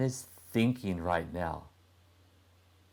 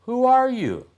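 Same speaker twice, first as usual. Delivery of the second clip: put-on boy's voice; 7 dB louder, faster than usual. Boy asks stranger at night.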